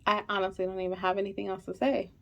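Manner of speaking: fighting not to cry; quiet, tearful words; holding back tears. Real, not acted. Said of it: "I'm trying so hard not to cry".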